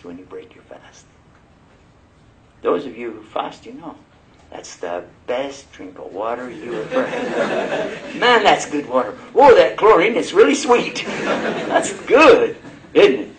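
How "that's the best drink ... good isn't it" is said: much higher and louder, laughing with pleasure; delighted savoring. Pretend. Fasting person gulping water at sunset, delighted. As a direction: relish every sip like a feast. Mock savoring after fast.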